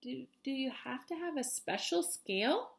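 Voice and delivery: a touch of nerves, asking quietly; timid stammer. Acted. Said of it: "the little striped fish asked".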